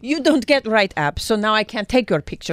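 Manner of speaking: put-on hammy Russian villain accent. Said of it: "Russian spy".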